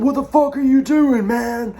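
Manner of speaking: high, loud shocked shout; American colleague yelling in disgust at the mooning. Retold or imitated. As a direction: shriek it at him, appalled. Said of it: "Steve is disgusted".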